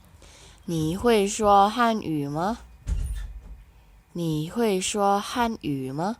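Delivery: very slow, careful Mandarin question; slow model reading. Shown as a demonstration. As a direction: spell it out for beginners. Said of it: "teacher models dialogue line".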